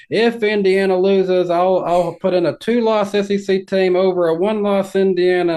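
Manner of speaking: high, loud, slow mock fan rant; mocking Twitter fans. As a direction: ham up an overexcited online fan. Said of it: "they're saying".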